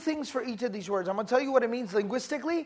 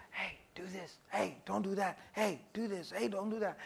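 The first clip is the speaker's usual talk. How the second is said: hissing softly, much softer; whispering tempter; 4 semitones lower, 13 dB quieter than usual. Retold or imitated. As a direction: whisper in their ear, nagging.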